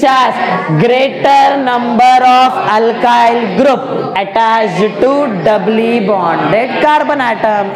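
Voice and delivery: very slow, each word spaced out; slow dictation to the class. Shown as a demonstration. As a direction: read it slowly so they can copy it. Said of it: "try करेगा, बोलो".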